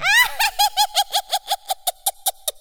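high shrieking monkey laugh; very high monkey cackle. Acted. Monkey laughs at his own downfall.

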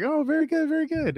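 squeaky mock pleasantry; high-pitched polite reply. Nervous comic replying to the client, high mock-polite voice. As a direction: answer far up high, over-polite.

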